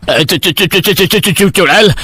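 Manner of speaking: high, frightened stutter; terrified stammer. Acted. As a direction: stammer in panic at the witch. Headman sees the witch, stammers. (डर से हकलाता मुखिया)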